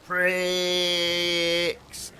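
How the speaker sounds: high elongated shout of pricks; shouted war cry. Shown as a demonstration. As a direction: bellow it, stretching the i. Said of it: "Like that".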